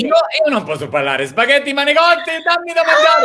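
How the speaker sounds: exaggerated Italian accent, rising high and loud; over-the-top Italian accent, climbing to a shout; hammed-up Italian. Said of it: "I love your Italian accent".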